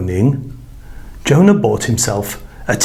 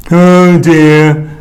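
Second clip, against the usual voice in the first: loud, drawn-out dismayed groan; 4 semitones higher, 6 dB louder than usual. A narrator's reading. Jonah sinking in the sea, groaning in dismay. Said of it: "groaned Jonah".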